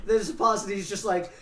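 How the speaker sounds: ordinary delivery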